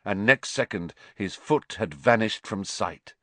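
usual speaking voice

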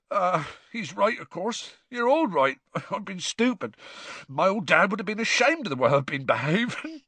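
lightly voiced rough half-giant, sniffly; a hint of teary gamekeeper laughing at himself; Hagrid's voice.

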